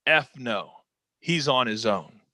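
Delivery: low, flat, curt brush-off; Vince McMahon's dismissal. Retold or imitated. The boss answering the cops, a low curt refusal. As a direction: dismiss him without a glance, low. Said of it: "He goes".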